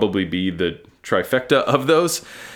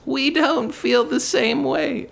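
very high, slow mock grandma; slower than usual. Speaker replaying the rejection as a joke, high grandma voice. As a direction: stretch it out in a piping grandma falsetto. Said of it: "turned that into a grandma voice".

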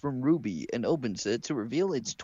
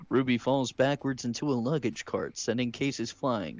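ordinary talk, nothing marked

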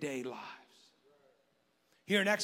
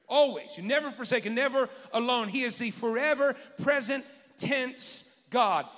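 louder, higher preaching build; full-force preaching; 6 semitones higher, 4 dB louder than usual. Acted. Pastor exhorting the congregation, full-force preaching. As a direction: preach it with fire.

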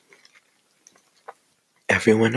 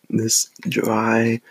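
whispering. Acted. ASMR whispered aside to viewers.

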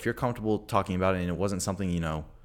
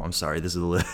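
normal talking voice

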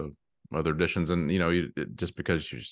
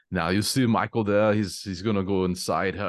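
imitating a thick Danish accent, higher; a light Danish director impression; 5 semitones higher, 4 dB louder than usual. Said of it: "that thick Danish accent".

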